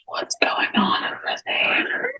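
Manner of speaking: slow breathy whisper to a friend. Acted. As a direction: lean in and whisper it slowly. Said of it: "lean over to Alex and just be like".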